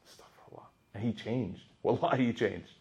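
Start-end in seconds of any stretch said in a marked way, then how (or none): 0.1-0.7 barely audible whisper; retold whispered plea for forgiveness; hushed remorse. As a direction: whisper it to yourself, humbled. Retold remorse of corrected husband.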